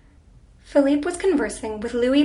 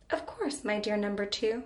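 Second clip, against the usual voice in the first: soft endearment; 2 semitones lower, 7 dB quieter than usual. A narrator's reading. Husband answering his wife's question, soft endearment. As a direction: answer softly, as if a private endearment. Husband reassures wife, dear number two.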